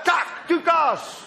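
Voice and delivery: high, drawn-out mock shout; comic cry of disaster; sudden snap. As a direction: burst out as the fishing line breaks. Acts out the line snapping.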